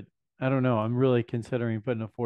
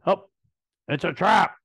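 put-on Admiral Ackbar's Mon Calamari accent, higher and louder; 7 semitones higher, 7 dB louder than usual. Card collector does his Ackbar, Mon Calamari accent. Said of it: "My Mon Calamari, uh, accent".

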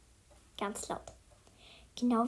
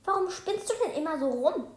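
raised, higher-pitched irritation; annoyed; 6 semitones higher, 6 dB louder than usual. A narrator's reading. Arne asking why the other is fooling around, annoyed. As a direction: snap it, pitch and volume up. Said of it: "fragt ihn Arne ärgerlich".

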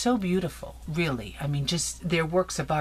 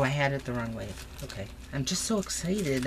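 ordinary talk

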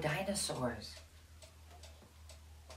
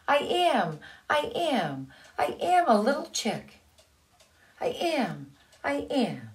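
insisting little duckling; 7 semitones higher than usual. Acted.

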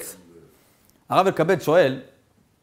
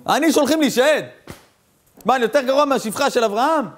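tearful plea; 8 semitones higher than usual. Acted. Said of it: "התחיל לבכות".

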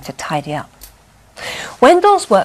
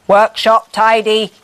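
robot voice; 2 semitones higher, 7 dB louder than usual. A narrator's reading. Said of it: "said the Wendell Bot".

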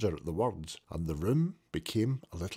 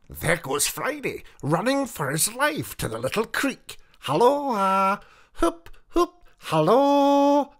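high, loud squawking parrot voice; 12 semitones higher, 9 dB louder than usual. Acted. Narrator voicing the parrot calling after Friday, squawking. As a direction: shriek it out like a parrot.

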